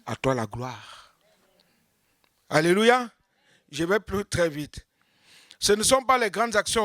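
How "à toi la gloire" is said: soft, slow, lower praise; humble prayer. Acted. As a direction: pray it quietly.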